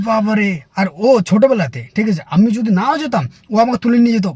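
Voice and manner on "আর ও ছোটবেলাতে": ordinary delivery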